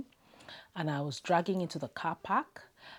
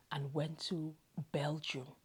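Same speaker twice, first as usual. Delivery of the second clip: ordinary delivery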